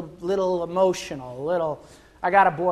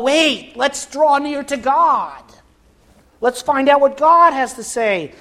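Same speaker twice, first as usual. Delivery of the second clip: priest's urging; 7 semitones higher, 5 dB louder than usual. Acted. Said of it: "the priest said unto Saul".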